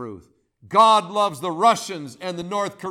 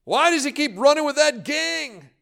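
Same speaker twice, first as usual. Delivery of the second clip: anguished cry; 6 semitones higher than usual. Retold or imitated. Parents crying out over a wayward son, anguished. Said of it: "family members cry out".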